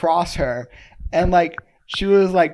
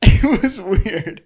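laughing; 5 semitones higher, 8 dB louder, much slower than usual. Real, not acted. Laughing at the odd encounter.

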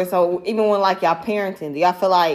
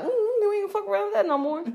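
higher imitating of kids swearing off trouble; mock chastened kids; 11 semitones higher, 6 dB quieter than usual. Mother mocks her daughters after a whooping. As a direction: say it like scared kids learned their lesson. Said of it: "They be like".

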